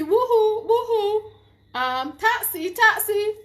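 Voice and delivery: high call, woohoo then taxi. Retold or imitated. Vlogger imitating the woman calling a taxi at night. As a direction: hail a taxi, high and loud. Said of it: "she said".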